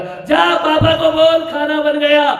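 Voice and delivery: high, loud summons; mother yelling. Retold or imitated. Mother sends son to tell papa dinner's ready, loud yell. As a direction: bellow the dinner call. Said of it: "यहाँ का संवाद वहां पहुंचाने वाला".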